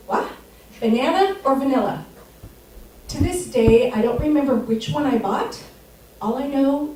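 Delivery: usual speaking voice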